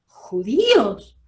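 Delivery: slow, stunned echo of the words; incredulous, drawn-out questions; shocked disbelief. Retold or imitated. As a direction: repeat it as if you can't believe it. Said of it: "dije yo".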